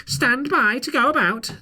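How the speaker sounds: high screeching parrot cry, loud; squawked ship's order, high and loud; screaming parrot. Acted. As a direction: shriek it like a pirate's parrot. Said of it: "the pirate would scream".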